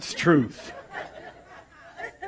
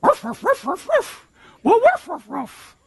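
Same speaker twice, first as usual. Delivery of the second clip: high playful barking; a preacher playfully barking like a dog; 9 semitones higher than usual. Pretend. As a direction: bark like a little dog. Imitating dogs.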